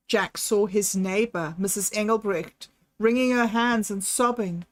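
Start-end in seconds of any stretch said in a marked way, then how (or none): none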